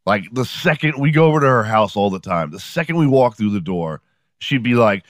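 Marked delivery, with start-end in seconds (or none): none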